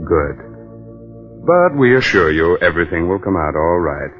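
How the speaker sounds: usual speaking voice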